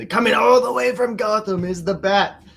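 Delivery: high, loud ring-announcer call; wrestling announcer. Pretend. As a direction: bellow it like a wrestling MC. Introducing the wrestler, ring-announcer style.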